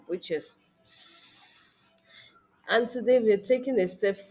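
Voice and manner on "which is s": S said as a hissed sss; S sound. Shown as a demonstration. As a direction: hiss the S as sss. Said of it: "the first sound in the first group".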